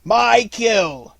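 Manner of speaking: loud, high snort of a claim; wolf snorting loudly over his prey. A narrator's reading. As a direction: bark it out over the kill. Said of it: "snorted the wolf".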